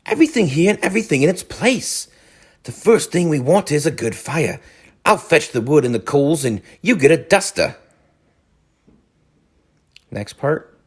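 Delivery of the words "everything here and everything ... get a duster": high, busy cheerful voice; cheery Rat. A narrator's reading. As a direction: bustle about giving orders. Rat's cheerful orders continue.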